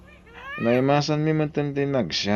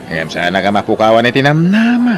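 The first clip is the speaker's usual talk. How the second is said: loud urging; 8 dB louder than usual. Acted. Friend urging him not to lose hope, loudly. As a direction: raise the voice to urge him on.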